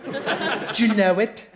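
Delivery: slow fake English accent; unique, changing accent. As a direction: brazen it out in a dodgy English accent. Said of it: "it has this unique accent".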